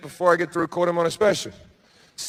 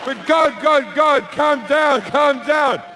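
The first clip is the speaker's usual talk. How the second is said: higher, pleading to calm him; pleading guests; 7 semitones higher than usual. Acted. Guests calming God down.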